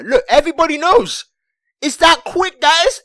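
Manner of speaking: excited. Real, not acted. Excited recap of the rumor.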